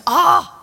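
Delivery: put-on loud high exasperated Ah from an old man woken again. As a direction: burst out fed up, loud and high. Eli exasperated, third time woken.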